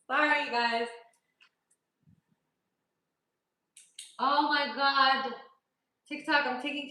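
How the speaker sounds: laughing. Real, not acted. Laughing fit on camera.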